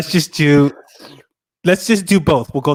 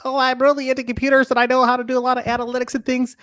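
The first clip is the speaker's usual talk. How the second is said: higher, fast nerd voice; nerdy computer guy; 10 semitones higher, much faster than usual. Retold or imitated. Friend mocks the show's computer guy, high and fast. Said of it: "some, like, computer guy".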